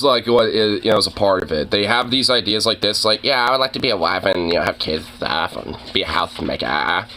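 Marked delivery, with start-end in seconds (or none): 3.2-7.1 a hint of a dumb valley-girl voice; lightly voiced valley girl. Mocking women who want to be housewives, lightly voiced valley girl. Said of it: "valley girl, like, you know, just dumb, headed voice".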